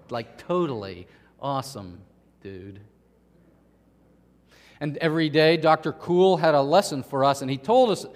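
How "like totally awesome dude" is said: laid-back California dude, trailing down low; lightly imitated California surfer dude. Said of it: "he is the quintessential California dude".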